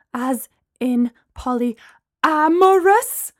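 slow, rising shocked question; shocked, drawn-out "polyamorous"; voice rising sharply. Acted. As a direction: let the end of "polyamorous" shoot up in alarm. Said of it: "Gemma's voice rose so sharply".

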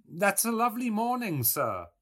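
put-on high, loud old woman's voice; old milkwoman. A narrator's reading. Old woman coming in with the milk, greeting, high-voiced. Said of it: "An old woman came forward".

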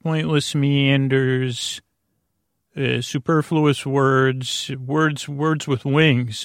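slow, soothing, drawn-out words; sleepy slow meandering delivery; lulling and slow. Shown as a demonstration. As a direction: let each word drift out slowly to lull the listener. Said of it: "I'm gonna use, uh, lulling, soothing".

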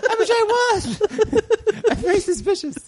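very high-pitched, distressed wasp voice; frantic wasp. Pretend. Giant wasp fearing blame for murders, squeaky comic panic. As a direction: squeal it like a wrongly suspected wasp.

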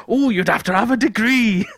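a light Welsh crooner voice; hint of a Welsh crooner. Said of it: "why he's [name]".

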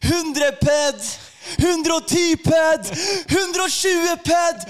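escalating comic yell to get someone's attention; building shouts. Retold or imitated. Calling rising speeds to distracted boss.

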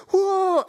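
high imitated crowd whoop; crowd cheer. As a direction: imitate a crowd heard from the dressing room. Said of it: "hear the sounds of the crowd".

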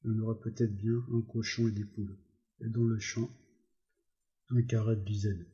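usual speaking voice

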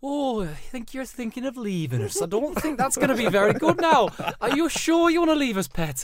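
high, slow Geordie call-centre voice; bad Geordie accent. Call-centre agent talking a customer out of cancelling, mock Geordie. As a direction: put on a hammy, high-pitched Geordie accent. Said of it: "Apologies for my terrible accent".

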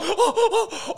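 high, bright burst of laughter. Real, not acted. Laugh after the story. (høj latter)

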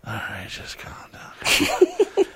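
slow, laughing whisper; telling himself to calm down amid gunfire; whispered self-calming. Retold or imitated. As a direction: soothe yourself in a slow whisper.

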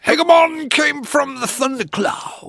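high, loud, slow declamation; grand poetry declamation. Retold or imitated. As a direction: proclaim it like an epic bard. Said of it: "the good stuff with his poetry".

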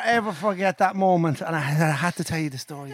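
normal talking voice

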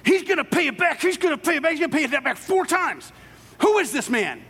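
high, loud outraged ranting; hammed-up fury, nearly shrieking; David raging at the thief in Nathan's parable, furious and loud; furious king. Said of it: "David gets all furious and he goes".

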